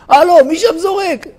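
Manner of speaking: high, startled call upward; surprised passerby calls up; startled shout. Retold or imitated. Passerby hit by stone. (קריאה מופתעת בקול גבוה)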